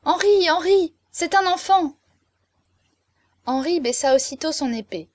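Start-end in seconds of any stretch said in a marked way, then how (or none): 0.0-1.9 high, loud cry of alarm. A narrator's reading. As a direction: call out to stop the fight, higher and louder. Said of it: "je ne pus m'empêcher de crier". (cri aigu et fort pour arrêter le duel)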